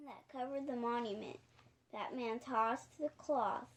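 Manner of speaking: ordinary delivery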